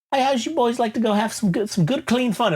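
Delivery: lightly hammed-up tempter offering clean fun; a hint of a shady host; imitating Colin Farrell.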